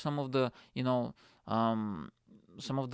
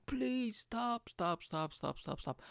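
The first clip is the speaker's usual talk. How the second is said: high-pitched begging, trailing softer; imitated panicked pleading; 10 semitones higher, 4 dB quieter, slower than usual. Scared child begging for the ride to stop, high pleading. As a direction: plead in a high voice, repeating it urgently. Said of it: "I'm screaming at that".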